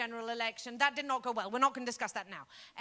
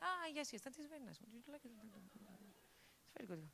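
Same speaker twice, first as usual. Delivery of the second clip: hushed leering man; 3 semitones lower, 15 dB quieter than usual. Retold or imitated. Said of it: "It's like".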